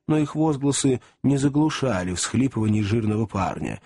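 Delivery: usual speaking voice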